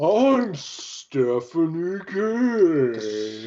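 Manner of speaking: very slow dopey sloth voice; super slow sleepy sloth. Pretend. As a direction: drag every word like a sloth. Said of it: "impression of a sloth".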